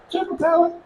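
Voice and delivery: high, soft cartoon turtle cry; Ninja Turtle impression. Speaker showing off his turtle impression to the crowd, high and soft. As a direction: chirp it like a cartoon hero turtle. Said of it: "that's my best turtle impression".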